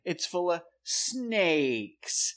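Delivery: very slow, dragged-out letdown; deflated disgust. Retold or imitated. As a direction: drawl out the disappointment. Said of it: "and I'm like".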